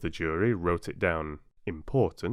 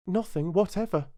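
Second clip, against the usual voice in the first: put-on girl voice; 12 semitones higher than usual. A narrator's reading. Alice answers king.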